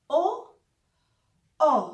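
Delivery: ओ with breath after; German O. Shown as a demonstration. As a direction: end ओ with an h. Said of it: "इस तरह से pronounce करते हैं". (ओ के बाद ह)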